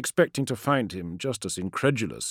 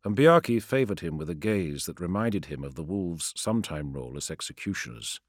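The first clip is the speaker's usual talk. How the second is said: usual speaking voice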